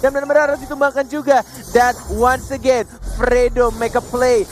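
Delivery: fast, loud play-by-play hype; esports caster. Real, not acted. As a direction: call the fight like a caster. Live play-by-play of fight.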